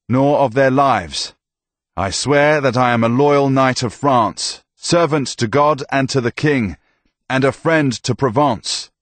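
solemn knight's oath. Acted. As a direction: vow it steadily to the priest. Tomas swears his oath.

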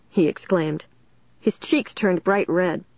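ordinary delivery, nothing marked

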